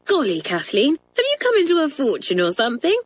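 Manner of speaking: put-on high, quick girlish exclamation; excited cry. A narrator's reading. Janet crying out at Kathleen's marvelous cake, high and excited. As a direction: gush it out in delight. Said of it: "cried Janet".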